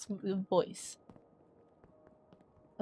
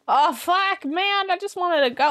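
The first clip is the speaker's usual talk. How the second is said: higher, louder exasperated cry; loud frustrated outburst; 8 semitones higher, 7 dB louder than usual. Real, not acted. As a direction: shout it when the game blocks you. Blocked in game.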